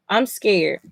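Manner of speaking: ordinary talk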